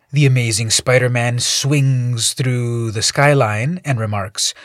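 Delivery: ordinary delivery, nothing marked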